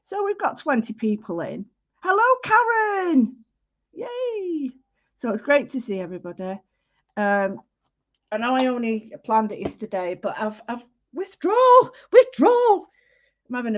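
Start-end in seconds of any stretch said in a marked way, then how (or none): 2.0-4.7 high, slow, gushing hello; excited greeting. Real, not acted. Welcoming a viewer to the live art session, gushing. 11.1-12.9 higher and louder wail of withdrawal; mock anguished. Real, not acted. Telling viewers she missed her friends, hammed-up wail.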